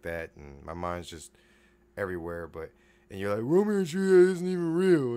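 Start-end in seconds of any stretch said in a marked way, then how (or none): 3.4-5.2 high, whiny-style mimicry of doubters; mocking objector. Imitating listeners who doubt Romeo and Juliet, mocking. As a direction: mimic a critic in a raised pitch.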